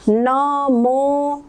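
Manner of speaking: नमो with the ओ held very long; long vowel. Shown as a demonstration. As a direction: stretch नमो out, hold the ओ. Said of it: "नमो यहाँ पे दीर्घ उच्चारण होगा".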